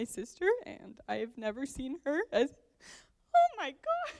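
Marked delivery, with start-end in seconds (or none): 3.3-4.1 very high, loud crying squeak; real tearful outburst. Real, not acted. Sister overcome while praising the groom, real tearful outburst. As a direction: break into tears on the words. Breaks into tears during the toast.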